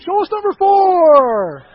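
big drawn-out game-show holler; booming, playful yell, as if hosting a game show; game-show shout. Acted. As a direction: shout it high, dragging out the last word. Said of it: "I feel like I'm on Family Feud".

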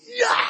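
high-pitched yell of frustration; frustrated yell. Retold or imitated. Driver losing it on the phone, frustrated yell. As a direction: let out a high, wild cry. Acted meltdown on phone call.

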